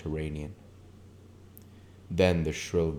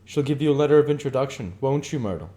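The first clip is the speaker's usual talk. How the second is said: quick, higher and louder through laughter; laughing; 9 semitones higher, 7 dB louder, faster than usual. Acted. Tom teases Myrtle, fast and laughing. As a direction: rattle it off amused. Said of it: "short shout of laughter".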